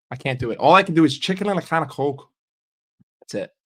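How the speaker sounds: usual speaking voice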